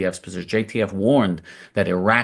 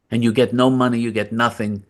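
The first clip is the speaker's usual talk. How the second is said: usual speaking voice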